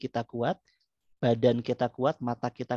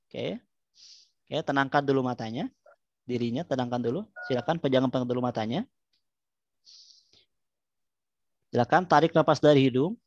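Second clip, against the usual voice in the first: calm guided breathing voice; soothing spa whisper. Acted. Leads breathing relaxation exercise.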